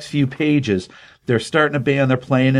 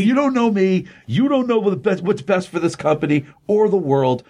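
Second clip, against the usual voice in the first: put-on much higher, a teenage rant at dad; higher mock-teen protest to his father; angry teen son; 7 semitones higher than usual. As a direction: play the sulky son talking back. Son yelling at father in quarrel.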